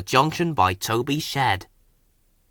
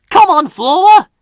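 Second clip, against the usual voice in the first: much higher, louder urgent call; whistled loudly; 12 dB louder than usual. A narrator's reading. Thomas rushing Flora away, loud and high. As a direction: hurry her along. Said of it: "he whistled".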